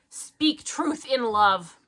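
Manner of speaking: drawn-out, very slow and solemn; slow preachy sign-off. Acted. Reading a preachy review ending to her co-host, very slow. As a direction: lay the moral on thick, slowly.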